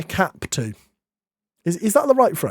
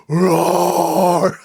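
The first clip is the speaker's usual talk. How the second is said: mock lion roar. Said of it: "Do your best roar".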